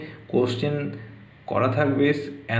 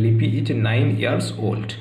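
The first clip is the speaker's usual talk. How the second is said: slow model answer; 2 semitones lower, much slower than usual. Shown as a demonstration. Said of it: "years করতে হবে".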